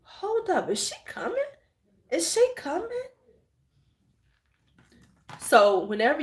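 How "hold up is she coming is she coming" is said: dawning suspicion. Retold or imitated. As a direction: realise she's coming for you. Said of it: "I was like".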